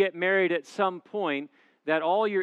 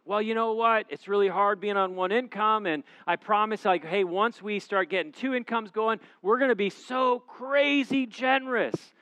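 higher, louder mocking voice of a stingy single; mock excuse-maker; 7 semitones higher, 6 dB louder than usual. Pastor imitating singles who promise future generosity. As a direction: poke fun at the empty promise.

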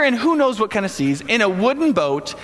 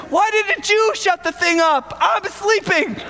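much higher, half-laughing whine; grumpy complaint; 11 semitones higher, slower than usual. Pretend. Woken Jesus scolding the disciples, grumpy and laughing. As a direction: grumble like someone woken too early. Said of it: "or it could have been".

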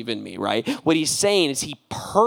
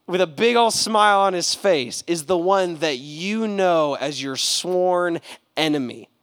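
normal talking voice

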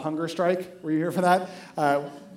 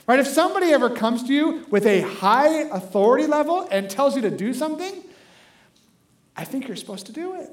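usual speaking voice

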